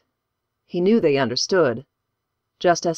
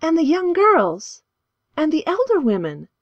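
put-on worried girl; 12 semitones higher than usual. A narrator's reading. A girl asks what happens to the others, high and anxious. Lucille keeps questioning.

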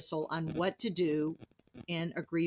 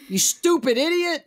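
higher and louder mock insult; blunt Northern insult; 9 semitones higher, 8 dB louder than usual. Joking about the blunt Northern version of bless your heart, mock loud insult. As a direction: snap it out like a rude Northerner. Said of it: "Northern version of that".

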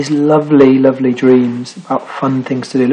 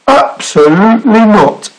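high, emphatic refusal; indignant denial; 8 semitones higher, 4 dB louder, slower than usual. A narrator's reading. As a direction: firmly reject the idea as the Snorg. Snorg indignantly denies adventuring.

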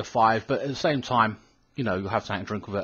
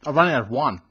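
eating and speaking; talking while chewing; faster than usual. Real, not acted. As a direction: mouth partly full. Seen 0: Holds cookie bowl; jaw and cheeks show chewing while talking.

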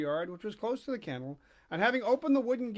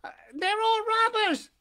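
put-on high, squeaky wooden-boy voice; high puppet voice; 7 dB louder than usual. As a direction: pipe it up like a puppet.

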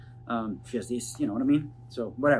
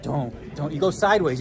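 ordinary delivery

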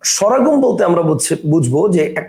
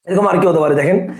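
ordinary talk, nothing marked

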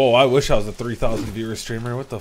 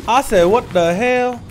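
high, loud, slowed-down repeat; slow, raised-voice exclamation; emphatic outburst; 9 semitones higher, 7 dB louder than usual. Real, not acted. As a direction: drag it out loudly for emphasis.

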